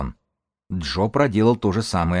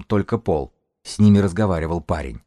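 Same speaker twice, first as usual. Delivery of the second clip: plain reading voice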